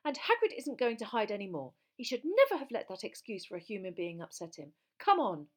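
heated, forceful anger; raging. A narrator's reading. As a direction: rail angrily, ending in a loud command. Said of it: "in a rage like this".

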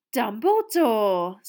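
loud, drawn-out gushing greeting; delighted cry. A narrator's reading. Reporter greeting the headmaster, loud gushing delight. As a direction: call his name with showy delight. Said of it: "cried Rita Skeeter".

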